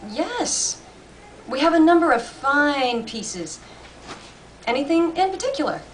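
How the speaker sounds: a hint of a friendly old salesman; old shopkeeper. A narrator's reading. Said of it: "The old gentleman at the far end".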